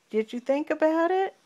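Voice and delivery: plain reading voice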